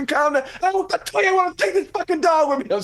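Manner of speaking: high, loud mocking wife voice; mimicking wife. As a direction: play her dismissive and loud. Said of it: "She's like".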